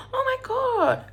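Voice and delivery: high-pitched, imitated gasping surprise; mock astonishment. Australians learning she is from Brisbane, mock astonished. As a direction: play up their astonished reaction. Retold shocked reaction to her hometown.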